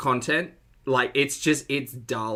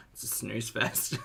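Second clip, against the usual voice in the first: normal talking voice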